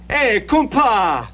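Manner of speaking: high, drawn-out hailing call; retold yell. Immigrant re-enacting his greeting to the priest, high drawn-out yell. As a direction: imitate calling out to a pal down the street. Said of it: "I yell".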